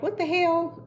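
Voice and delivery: an incredulous what-the-hell; exasperated, high-pitched disbelief; shocked disbelief. Retold or imitated. Car smoking, people staring.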